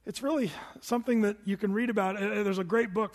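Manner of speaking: ordinary delivery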